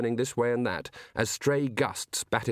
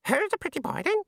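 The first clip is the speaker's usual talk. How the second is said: very high-pitched parrot squawk; shrieking budgie. A narrator's reading. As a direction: shriek it like a talking budgerigar.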